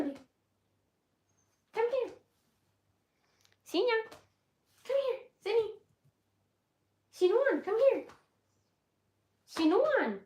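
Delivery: high, coaxing pet-calling voice; calling a dog. Real, not acted. Owner calls the dog to come here, high coaxing. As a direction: call the dog over in a high singsong.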